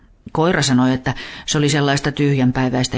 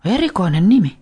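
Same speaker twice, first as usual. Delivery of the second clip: put-on higher, louder girl's remark; amused girl; 6 semitones higher, 4 dB louder than usual. A narrator's reading. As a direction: Veera marvelling at the name. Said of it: "Veera sanoi".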